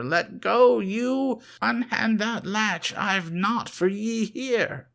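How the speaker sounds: put-on high, loud, raving old wizard cursing; frantic old man's curses. A narrator's reading. Old magician cursing the narrator and the dead, high frantic raving. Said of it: "barked things in his throat".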